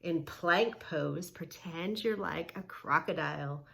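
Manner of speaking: ordinary talk, nothing marked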